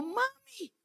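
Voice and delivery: put-on high, drawn-out childlike amazement; retold wide-eyed wonder in a high voice; surprised little daughter. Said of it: "Albita se sorprendió y dijo".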